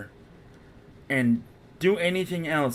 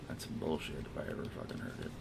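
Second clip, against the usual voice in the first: soft whispered complaint; muttering at the game, almost silent; whispered grumble; 10 semitones lower, 14 dB quieter than usual. Real, not acted. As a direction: whisper it under your breath in disgust. Annoyed at game mid-fight.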